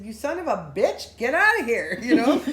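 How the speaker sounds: loud, laughing mock yell at the spirit; loud commanding yell with a laugh; angry yell. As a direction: order it out of the car. Yelling at the spirit.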